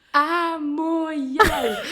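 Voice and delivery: loud, high, very drawn-out mock annoyance; retold exasperation. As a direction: spell it out slowly as if to someone dense, half laughing. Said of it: "je m'énerve et je commence à lui parler". (exaspération rejouée, nom crié en traînant, aigu)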